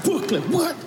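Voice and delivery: high-pitched Brooklyn hype call; mock Brooklyn bravado. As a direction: shout out Brooklyn with exaggerated swagger. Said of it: "I had to say it like that".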